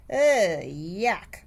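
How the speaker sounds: a loud grossed-out "ugh" then a sour "yuck"; disgusted. A narrator's reading. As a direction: recoil from the smell. Girl disgusted by friend's sandwich.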